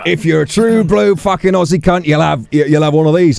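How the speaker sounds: hammed-up Australian pub voice; broad Aussie. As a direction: boast like a true blue Aussie in the accent. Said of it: "not that I've not done an Aussie".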